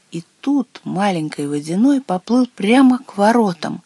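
plain reading voice

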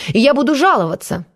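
high-pitched indignant threat; worked-up. A narrator's reading. Pious man threatens to complain, worked-up. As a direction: fume petulantly, pitch up. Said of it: "кипятился Иннокентий".

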